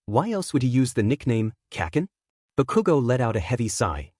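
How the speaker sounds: plain reading voice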